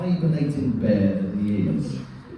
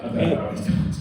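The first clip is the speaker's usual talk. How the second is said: normal talking voice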